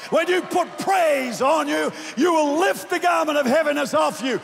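rally the congregation, full voice; preaching at full force. Acted.

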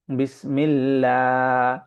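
বিসমিল্লাহ with a thin, light lam, mouth open, slowly drawn; thin lam recitation. Shown as a demonstration. Teacher showing the thin lam in বিসমিল্লাহ, slow recitation. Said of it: "ফাঁকা করে পড়ব".

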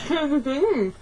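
garbled through a stuffed mouth; mouth full. Real, not acted. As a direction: cheeks packed full. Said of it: "stick it in your mouth".

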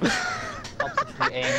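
mocking voice. Teammate mocking bad aim.

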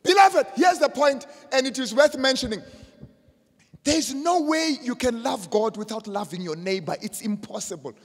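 high, loud pulpit call, easing slightly; emphatic preaching. Acted. Preacher telling the beloved what is worth mentioning, emphatic preaching. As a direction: call the congregation to the point.

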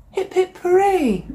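high, loud cheer. A narrator's reading. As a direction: cheer like the kids would. Bird finds the bow.

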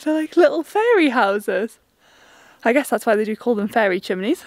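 ordinary delivery

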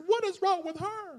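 very high, squealed imitation of indignation; exaggerated, high-voiced offended comeback; mock upset retort. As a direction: throw it back as a comic high-pitched protest. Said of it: "I was so upset".

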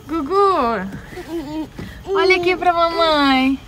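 tender cooing. Real, not acted. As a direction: mom cooing to her teary son. Mom cooing to crying child.

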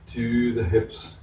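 ordinary delivery

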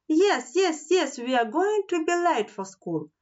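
high-pitched, anxious storybook cry; urgent cry. A narrator's reading. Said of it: "Nelly cried".

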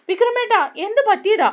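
high, loud worried cry of his name; anxious call. Retold or imitated. Radha bursts in calling Vikram. (ഉയർന്ന സ്വരത്തിൽ പരിഭ്രമത്തോടെ വിളി)

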